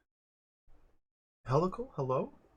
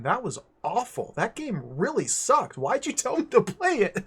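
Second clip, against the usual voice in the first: mock outrage, rising high and loud into laughter; hammed-up, high-pitched griping; imitated indignant complaint; 8 semitones higher, 5 dB louder than usual. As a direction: act out an aggrieved friend. Retells friend's indignant complaint.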